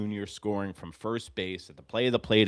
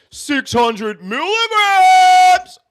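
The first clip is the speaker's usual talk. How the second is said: much higher, much louder, slow drawn-out yell; triumphant shout; 15 dB louder, much slower than usual. Real, not acted. Fan yelling about his aspirin dose, huge drawn-out shout. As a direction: bellow it out long and high.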